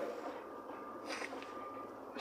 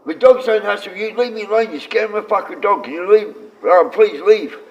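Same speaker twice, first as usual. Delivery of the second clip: higher-pitched, insistent retold plea; retold pleading; 7 semitones higher than usual. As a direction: plead to be left alone, retelling it. Retold plea to hostile group.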